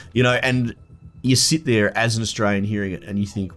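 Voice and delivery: ordinary talk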